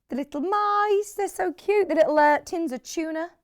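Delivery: high-pitched, slightly American cooing; American accent. Real, not acted. Host gushing over little fabric motifs, slipping into an American accent. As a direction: gush with a hint of an American accent. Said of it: "said that in an American accent".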